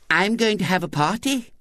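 put-on high-pitched child's announcement; little-girl voice. A narrator's reading. Girl breaking the boys' silence, high childish voice.

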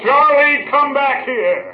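high, loud, drawn-out holler; indignant high shout across a distance; calling after him. Acted. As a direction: order him back from afar. Calls Charlie back as he leaves.

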